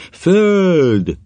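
high, drawn-out cry of discovery; piercing cry. A narrator's reading. Alchemist crying out land before anyone speaks, piercing cry. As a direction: cry out high and long, then fall. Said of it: "éles, sikongó kiáltás".

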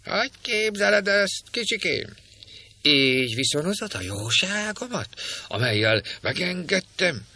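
high, shrill-voiced crone scolding; a nasty old woman scolding the girl in a screech; screeching old hag. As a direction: cackle it out like a spiteful old witch. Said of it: "Alfrednek rikácsoló hangja".

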